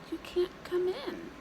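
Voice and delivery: plain reading voice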